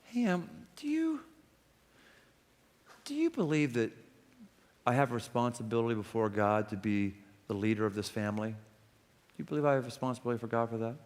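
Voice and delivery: soft, careful, low-key asking; gentle careful question. Retold or imitated. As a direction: ease into it gently. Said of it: "I went to this person and said".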